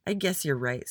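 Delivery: usual speaking voice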